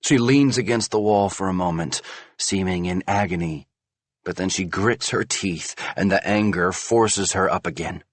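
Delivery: usual speaking voice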